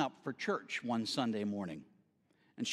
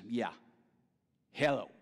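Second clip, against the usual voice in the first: unwelcoming; 4 semitones lower than usual. Shown as a demonstration. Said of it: "I greet him like this".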